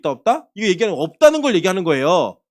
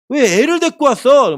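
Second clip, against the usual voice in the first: louder, higher mock scolding; retold scolding; 4 semitones higher, 5 dB louder than usual. Disciples rebuking people who brought children, mock scolding. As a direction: scold as the disciples, mildly acted. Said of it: "제자들이 막 꾸짖어요".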